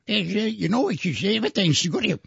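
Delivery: high, quiet old-timer impression; Walter Brennan impression. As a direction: put on an old movie sidekick's voice. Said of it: "Walter Brennan".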